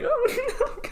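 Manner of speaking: high, laughing mock wail; mock dismay. Podcaster retelling finding out their star sign, mock dismay. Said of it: "I was like".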